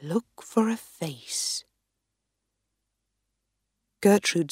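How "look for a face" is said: put-on slow, hushed old man's voice; whispering sage. Wise man instructs Gertrude.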